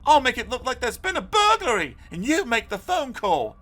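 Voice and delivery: high, loud, eager planning; excited boy. A narrator's reading. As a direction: hand out the roles with excitement. Boys plan the fake burglary.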